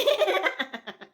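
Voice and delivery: high-pitched burst of laughter; laughing. Real, not acted. Reviewer amused by a contestant's outbursts, laughing. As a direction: break into a high, drawn-out laugh.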